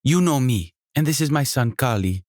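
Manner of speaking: high, lively hostess voice with a faint Jamaican lilt; a welcoming, bubbly landlady; energetic innkeeper. Acted. Sonia introduces son.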